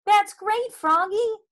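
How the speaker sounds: high, loud praising mother; Mom. A narrator's reading. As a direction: cheer on your child proudly. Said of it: "said Mom".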